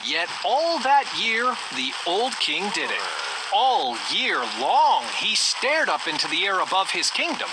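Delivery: ordinary delivery, nothing marked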